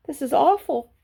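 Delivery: put-on a frightened young pig thinking aloud; lightly voiced scared piglet; Wilbur's fretful thought. A narrator's reading. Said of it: "thought Wilbur".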